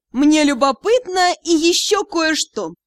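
high, slow, insinuating line; hinting. A narrator's reading. Ghost probing the captain's curiosity, hinting. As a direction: draw it out with a pointed hint. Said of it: "с намёком".

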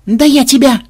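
high loud lunging threat; furious threat. Acted. Xenobyte lunging at his double, loud and furious. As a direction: go for him in a rage. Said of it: "задохнулся от ярости".

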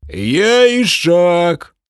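high, slow, loud cry; piercing shout. Acted. Porter half-orc yelling his trade to crowd, piercing. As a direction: yell it out piercingly. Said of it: "пронзительно выкрикнул".

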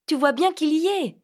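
high, loud triumphant shout. Acted. Vinca to Phil over the eel's lair, a loud high shout. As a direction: yell it out, proud of the catch. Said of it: "cria-t-elle".

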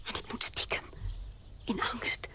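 whisper. Acted. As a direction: whisper it furtively. Whispered secrecy. (stiekem fluisterend)

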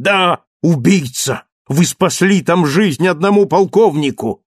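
high, loud and slowed accusation; angry accusation. Acted. Marius accusing Thenardier to his face, angry. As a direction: spit out the accusation.

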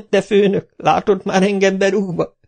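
high, whiny protesting voice; lackey Kapa whining at his boss. A narrator's reading. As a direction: whine it like a put-upon drunk. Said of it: "nyekergett Kapa".

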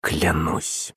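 very soft, low oath; hushed, defeated vow; almost whispered. A narrator's reading. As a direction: swear it barely above a whisper. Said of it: "чуть ли не шёпотом ответил князь".

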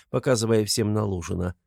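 usual speaking voice

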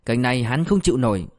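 usual speaking voice

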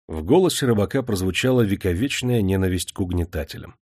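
plain reading voice

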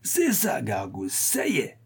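put-on soft, lurching drunken babble; drunken mumbling. A narrator's reading. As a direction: stumble softly through it, pitch swinging. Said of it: "dit Coupant d'Œuf en balbutiant".

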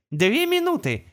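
high, prompt navigator reply; gps voice. Acted. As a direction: answer at once like a navigator. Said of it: "незамедлительно откликнулся навигатор".

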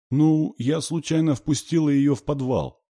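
put-on high, small child's voice; high, plaintive little-girl admission; sniffly little girl. A narrator's reading. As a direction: confess like a child near tears. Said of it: "Катя шмыгнула носом".

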